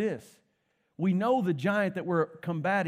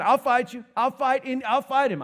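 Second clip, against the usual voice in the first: raised, loud volunteering; acted battle-ready insistence; eager challenge; 6 semitones higher, 5 dB louder than usual. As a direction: step up and call out for the fight. David answers the giant's challenge.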